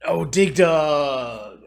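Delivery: a real eureka, stretched long and loud; excited realization. Real, not acted. Sudden realizing the quiz answer.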